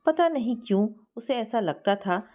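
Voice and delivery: ordinary delivery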